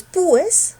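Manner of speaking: "pues" split as PÚ-es with a long U; wrong stress on U. Shown as a demonstration. Teacher testing for a hiatus, wrongly stressed U in pues. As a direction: stretch the U in "pues" into its own syllable. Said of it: "La fuerza no está en la U".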